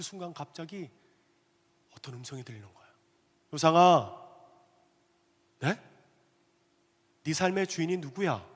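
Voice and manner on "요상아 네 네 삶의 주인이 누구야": a lightly voiced calling from above, loud on the name; a hint of God's voice asking the husband. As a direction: call him by name like a voice from heaven. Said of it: "하나님의 음성이었어요".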